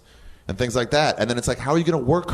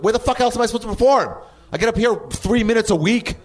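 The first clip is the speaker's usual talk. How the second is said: much higher, louder and fast, an exasperated yell; high, fast, frustrated shout at the old club owner; retold yelling; 13 semitones higher, 4 dB louder than usual. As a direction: re-enact ranting at her about open mics. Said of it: "Stop yelling at me".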